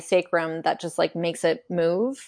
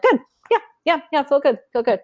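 high, quick, brushing-off yeah yeah; dismissive stock answer; 8 semitones higher, 3 dB louder, faster than usual. Retold or imitated. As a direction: mimic someone dodging the question. Imitating yoga students' stock answer.

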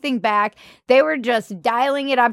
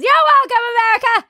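much higher, louder triumphant proclamation; mock grand; 13 semitones higher, 6 dB louder, much faster than usual. Sarcastic hosts to America, grand proclamation. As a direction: declare it like a self-congratulating hero. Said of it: "mock grand sign-off".

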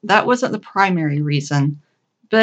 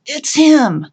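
urgent alert; 9 semitones higher, 6 dB louder than usual. A narrator's reading. Urgent aside to coworker on hold.

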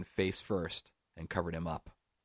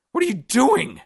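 very high, loud shriek; full-out panicked yell; terrified scream; 12 dB louder than usual. A narrator's reading. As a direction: scream it in sheer alarm. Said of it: "Scottish Steve screamed".

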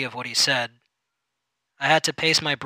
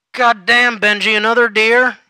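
much higher and louder outburst; exasperated exclaim; 8 semitones higher, 10 dB louder than usual. Acted. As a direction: boss blurting out in disbelief. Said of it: "he exclaimed".

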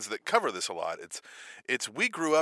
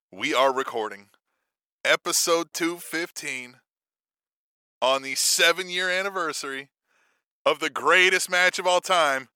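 big deliberate build-up to a match; show announcer; 4 dB louder than usual. Acted. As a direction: announce it grandly, pausing between phrases.

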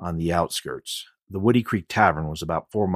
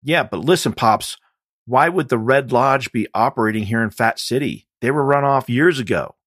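agitated muttering man; 7 semitones higher, 8 dB louder than usual. Acted. Man arguing with unseen person.

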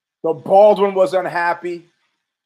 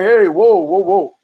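alarmed shout; 6 dB louder, slower than usual. Retold or imitated. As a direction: back off, shouting whoa in alarm. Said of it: "he's like".

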